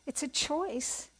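slow, weighted words, each one set apart; slow emphasis. Real, not acted. Speaker stresses to her audience that listening is a choice, slowly. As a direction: let the point sink in slowly.

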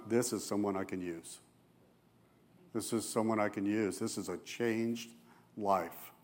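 soft, low and hushed; tender awe. Retold or imitated. Jesus watching the forgiven woman leave, hushed and tender. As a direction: say it quietly, full of wonder.

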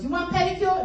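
mock the nail-salon lady's broken-English accent. The nail lady asking a customer, in an imitated nail-salon accent. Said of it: "my daughter does this amazing accent".

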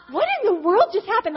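high, loud retold astonishment; acted bewilderment. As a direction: reenact being utterly baffled, pitch up. Acts out her sudden bewilderment.

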